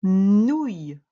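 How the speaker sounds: loud, drawn-out dictated syllable; dictation. Shown as a demonstration. Teacher dictating the first syllable to a pupil, loud and drawn out. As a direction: call the syllable out for writing down. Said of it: "Et la dernière syllabe".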